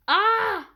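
high, loud pained cry. Real, not acted. Gamer crying out when his brawler is hit. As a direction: cry out as if hurt.

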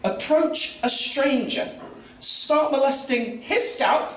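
deliberately drawn-out incredulous pacing; slow each phrase down, disbelieving; slow for emphasis. Acted. Comic recounting the toilet stranger, slow disbelieving build.

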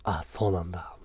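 low, whispery heartthrob voice; laid-back, half-whispered reply; husky mumble. Shown as a demonstration. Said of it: "ちょっとね、かすれ声で、ボソボソ喋る".

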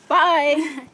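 usual speaking voice

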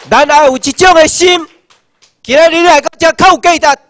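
a rousing oration building to a climax; fiery rally oratory. Acted. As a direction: preach it to the crowd. Rally speech at full force.

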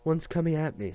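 high, loud narrated scream. A narrator's reading. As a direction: cry out as it comes at you. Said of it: "screamed Chacho".

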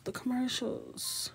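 "commercials" drawn out high and slow, overcome; overwhelmed. Real, not acted. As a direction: stretch "commercials" up high like it's too much to bear. Repeating commercials, second more emotional.

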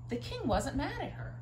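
usual speaking voice

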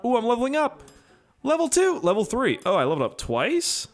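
real delighted squeal of rising pitch, level after level; cheering each level up like a jackpot; high, bubbly gamer excitement; excited. Real, not acted. Game levels up twice.

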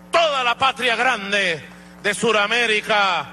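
ordinary talk

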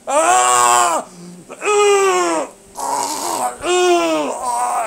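loud high pained yells; pained shouts. Real, not acted. Hurting foot and body, loud high pained yells. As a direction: cry out in real pain. Cries out in pain.

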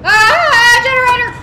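high loud relieved yell; loud shout. Real, not acted. Streamer spotting the generator, high loud shout. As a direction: shout it out in a rush. Finding generator under pressure.

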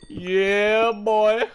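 high, loud hyped celebration; elated cheer. Real, not acted. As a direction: cheer your lucky find. Celebrating lucky diamond find.

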